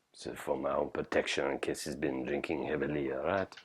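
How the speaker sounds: low, very soft confiding voice; hushed aside. Acted. Chef warily preparing to find his drunk helper, hushed aside. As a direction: confide it under your breath.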